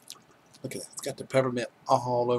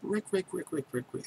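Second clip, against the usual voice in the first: higher chirping bird call, rapid repeats; bird call imitation; 8 semitones higher, 6 dB quieter than usual. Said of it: "y'all gonna hear Rick".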